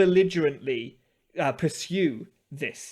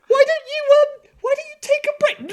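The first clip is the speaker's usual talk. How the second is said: very high, louder falsetto plea; mock timid suggestion; 8 dB louder than usual. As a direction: squeak it out nervously. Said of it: "I'll like".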